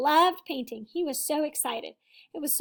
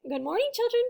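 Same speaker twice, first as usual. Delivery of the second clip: high, cheerful teacher greeting; beaming teacher; 8 semitones higher, faster than usual. Acted. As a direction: beam at the class. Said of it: "beamed the teacher".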